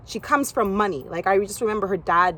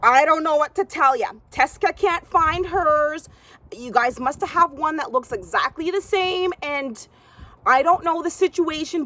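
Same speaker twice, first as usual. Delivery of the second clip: dismissive owner; 6 semitones higher than usual. Retold or imitated. Gym owner dismissing the young girl, high brush-off. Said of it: "And she's like".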